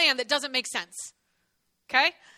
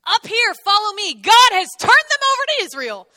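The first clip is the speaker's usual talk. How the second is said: shouted command; 7 semitones higher, 11 dB louder than usual. Retold or imitated. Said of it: "Jonathan shouts to his armor bearer".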